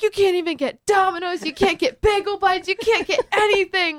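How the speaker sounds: high-pitched mock outrage, building to the end; retold rallying speech. Film-maker riling up bar patrons, retold yelled speech. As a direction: hammer out each loss to the crowd. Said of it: "giving them this, like, speech".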